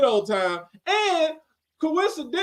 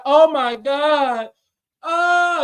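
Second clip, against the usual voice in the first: mimicked female moan; 6 semitones higher, much slower than usual. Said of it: "you hear a female, like".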